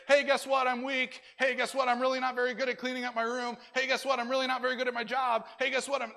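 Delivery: loud, high, fast mock bragging about weakness; hammed-up kid loudly broadcasting his flaws; mock boastful announcing. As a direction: call it out like a silly show-off. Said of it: "go around and say".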